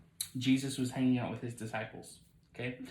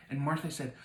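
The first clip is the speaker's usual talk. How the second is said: usual speaking voice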